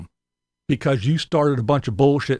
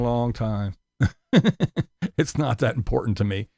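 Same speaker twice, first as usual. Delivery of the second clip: ordinary talk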